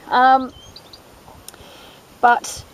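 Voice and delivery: teary, sniffly voice with a runny nose; choked up and sniffling; snotty crying. Real, not acted. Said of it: "my nose is running".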